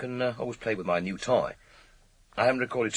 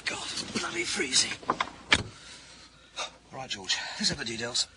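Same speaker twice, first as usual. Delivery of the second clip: shivering in the cold; 3 semitones higher, 5 dB quieter, slower than usual. Acted. Detective arriving at night, shivering with cold. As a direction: gasp at the freezing air.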